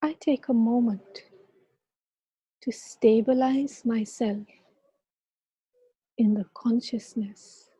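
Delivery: calm guiding voice with long pauses; guided meditation. Acted. Leads a meditation.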